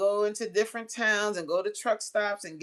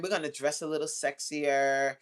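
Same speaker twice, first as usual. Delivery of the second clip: ordinary talk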